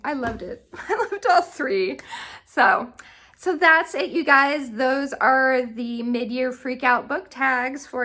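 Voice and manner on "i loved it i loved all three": laughing gush. Real, not acted. Gushing about favorite book.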